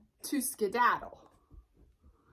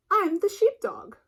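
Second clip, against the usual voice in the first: a lightly voiced dog, higher and louder; sheepdog voice; 9 semitones higher, 6 dB louder than usual. Sheepdog introduces himself to Sheep, lightly voiced. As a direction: answer brightly as the dog. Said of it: "the someone replied".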